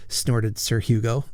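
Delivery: plain reading voice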